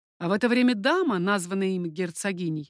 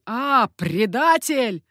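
loud, slow, drawn-out accusation; furious accusation; 2 semitones higher, 7 dB louder, much slower than usual. A narrator's reading. Coconnas lunging at the youth, furious accusation. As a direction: hurl it loudly, stretching the word.

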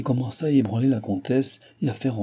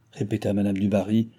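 ordinary delivery, nothing marked

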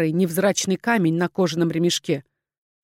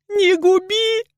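high, drawn wail of terror; trembling, desperate cry; frantic begging; 13 semitones higher, 4 dB louder, much slower than usual. A narrator's reading. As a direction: plead for your life, pitched way up. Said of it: "затряслась она в неистовой дрожи".

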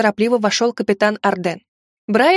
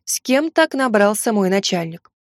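ordinary delivery, nothing marked

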